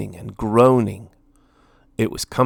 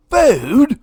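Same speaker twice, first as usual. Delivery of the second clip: higher, louder outraged question; indignant cry; 14 semitones higher, 8 dB louder than usual. A narrator's reading. Lord Gurr mocking Minnie's plea, outraged cry. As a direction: scoff at the request. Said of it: "cried Lord Gurr".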